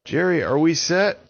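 high, loud call. Acted. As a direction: call across the lake. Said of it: "he cried out to Jerry".

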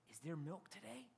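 put-on soft aside in a kid's words; child's tentative question. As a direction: ask it quietly, as an imagined child. Voices kids' worried household question.

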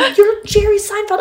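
very high, incredulous exclamation; awed disbelief. Retold or imitated. Reminding Jerry who he is, high astonished voice.

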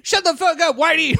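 very high-pitched mock outburst; imitated angry yell. Mimicking seventies sitcom characters' outbursts, mock furious yell.

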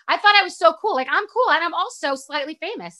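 high, animated mock boasting; mock self-importance. Cool mom retelling her misplaced confidence, mock boastful. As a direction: puff yourself up, sending up your own confidence.

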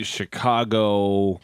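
normal talking voice

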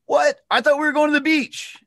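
high, loud, playful mock surprise; joking mock surprise. Joking with a prospect who says they're not selling, high and playful. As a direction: bust in with a silly high voice. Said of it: "bust in with a joke".

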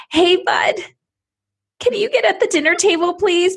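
the wrong way: sugary high voice hiding anger; fake-sweet angry. Shown as a demonstration. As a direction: put on a fake smile over rage. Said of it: "anger and rage under your voice and you're just putting a fake smile".

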